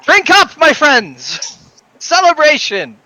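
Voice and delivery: high, rousing call to the room; hearty toast. Acted. Rodney hands the mugs out, hearty celebratory call. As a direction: proclaim a toast to friends.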